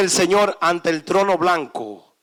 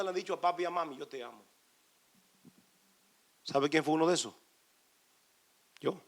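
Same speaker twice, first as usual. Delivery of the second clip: much softer, lower confiding talk; soft low confession; quiet testimony; 6 semitones lower, 6 dB quieter than usual. Real, not acted. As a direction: share it gently and quietly. Long quiet personal testimony.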